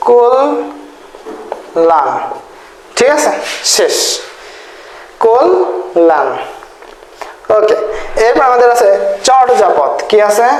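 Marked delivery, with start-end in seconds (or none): 0.1-2.1 কল্যাণ as kol, long pause, lan; syllable by syllable. Shown as a demonstration. Teacher showing how we really say it, in two slow pieces. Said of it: "মূলত আমরা বলি কি". 5.2-6.3 কল্যাণ split as kol and lan; syllable by syllable. Shown as a demonstration. Teacher repeating the sound for writing, in separate syllables. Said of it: "মূলত আমরা বলি কি".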